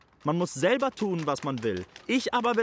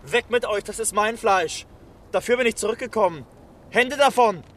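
higher, louder furious outburst; angry shouting; 4 semitones higher, 5 dB louder than usual. Acted. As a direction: drive the mob back, shouting. André fights off the crowd.

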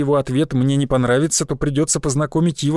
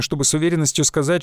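usual speaking voice

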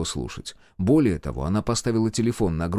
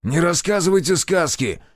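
raised, higher-pitched retort; irritated retort; 8 semitones higher, 3 dB louder than usual. A narrator's reading. Armed major rejects the healer's explanation, irritated. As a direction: cut the excuse off, mildly angry.

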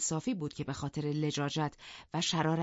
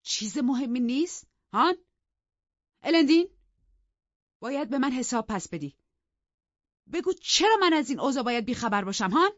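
higher, louder angry demand; 9 semitones higher, 9 dB louder than usual. Acted. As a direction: demand answers from the servant. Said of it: "با عصبانیت گفت".

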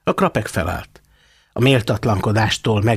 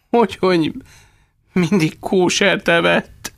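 whimpering; 9 semitones higher than usual. A narrator's reading. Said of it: "nyöszörögte".

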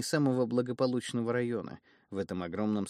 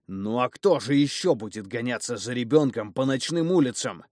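angry; 3 semitones higher, 7 dB louder than usual. Acted. Father keeps scolding.

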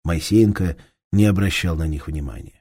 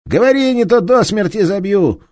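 higher, louder furious bellow; angry detective shouting down a thief; enraged police inspector; 13 semitones higher, 9 dB louder than usual. Acted. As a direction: roar at the thief with fury.

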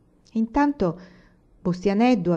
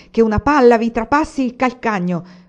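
cursing; 4 semitones higher, 7 dB louder than usual. A narrator's reading. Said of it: "continuava ad imprecare zio Pera".

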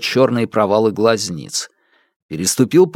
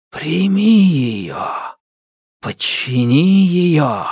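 slow, higher menacing spirit; ominous darkness voice; 4 semitones higher, slower than usual. Acted. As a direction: intone it like a dark spirit. Darkness tempts him.

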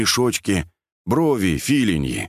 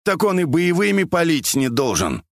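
higher-pitched retort; reasoned objection; 8 semitones higher than usual. A narrator's reading. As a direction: counter it with a raised, arguing tone. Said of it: "резонно возразил Андрюха".

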